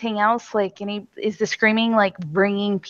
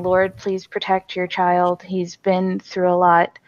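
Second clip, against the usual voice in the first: prayer; 4 semitones lower than usual. Acted. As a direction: pray over him steadily for protection. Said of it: "I am saying".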